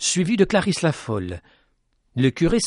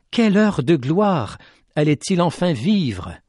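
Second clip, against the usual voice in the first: ordinary delivery, nothing marked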